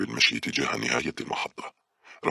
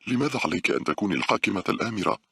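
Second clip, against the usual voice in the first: complaining; 7 semitones higher than usual. A narrator's reading. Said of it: "قالت باولا شاكية".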